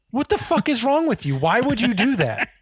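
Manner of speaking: high-pitched, incredulous scolding; imitated angry berating. Chef berates waiter caught drinking water, high and outraged. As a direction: act out a chef's outraged questioning.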